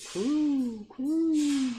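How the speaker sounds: high cooing dove. Acted. Dove sound in story.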